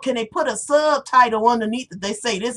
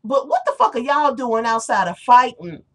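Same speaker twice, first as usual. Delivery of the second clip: usual speaking voice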